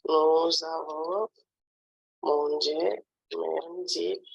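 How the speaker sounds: nous avons drawn out, pause, then mangé; slow dictation. Shown as a demonstration. Teacher repeats the verb form for a pupil, slow dictation. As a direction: stretch it slowly in two parts.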